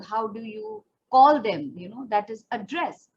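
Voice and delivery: usual speaking voice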